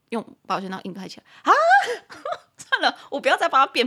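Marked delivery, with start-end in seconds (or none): 1.6-2.4 loud high-pitched laugh; shrieking laugh; high burst of laughter. Real, not acted. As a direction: crack up at yourself. Laughing at own argument.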